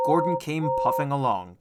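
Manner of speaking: usual speaking voice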